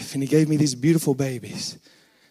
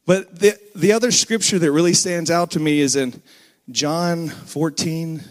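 ordinary delivery

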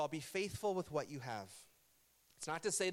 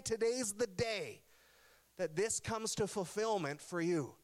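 preaching higher and building toward the promise; rising preacher climax; 5 semitones higher than usual. Acted. Preacher to congregation, rising preaching fervor. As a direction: lift the room toward the promised day.

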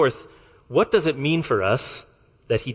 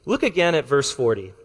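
ordinary talk, nothing marked

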